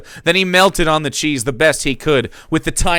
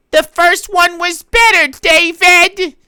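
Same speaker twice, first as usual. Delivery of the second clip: put-on much higher, louder old-lady voice; mother's voice; 8 dB louder, slower than usual. Mother giving her verdict to Dave in a high old-lady voice. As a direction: squeak it out like a sweet old mom. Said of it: "with a weak smile".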